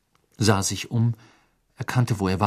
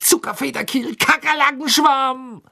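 angry shout; 6 dB louder than usual. Acted. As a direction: yell it while hopping in pain. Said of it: "schrie er zornig".

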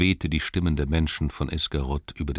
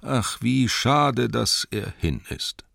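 slow, higher lament; mournful sigh; 7 semitones higher, 3 dB louder, slower than usual. Acted. Townsfolk mourn their lost archer, slow wistful lament. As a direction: linger on the loss. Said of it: "sagten sie alle".

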